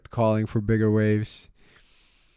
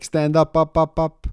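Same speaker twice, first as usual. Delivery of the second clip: calling out; 7 semitones higher, 5 dB louder than usual. Retold or imitated. Instructor calling out on wave.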